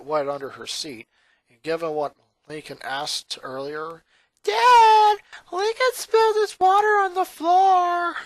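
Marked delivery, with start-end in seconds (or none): 4.4-8.2 put-on high, loud bratty girl voice; tattling little girl. A narrator's reading. Sister telling on her brother to Dad, loud high tattling.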